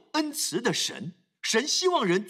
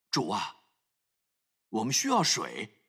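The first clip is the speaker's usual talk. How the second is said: soft, lower plea to the Lord; quiet humble plea; 11 semitones lower, 3 dB quieter than usual. Retold or imitated. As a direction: ask God quietly for water. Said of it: "他就只需要说". (轻声谦卑地祈求)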